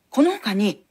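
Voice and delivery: ordinary talk, nothing marked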